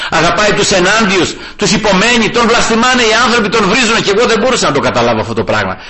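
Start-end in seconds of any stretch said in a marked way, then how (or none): none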